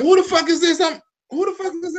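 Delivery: high-pitched mock bewilderment; confused high panic. Imitating a too-high person not recognizing his partner, mock bewilderment. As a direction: ham up the lost, stoned stare. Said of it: "forget who you having sex with".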